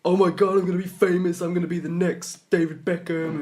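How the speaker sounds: mimicking reality show hopefuls, overexcited and high; over-exaggerated excitement. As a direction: ham up a wannabe star's giddy hopes. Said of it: "over-exaggerated people".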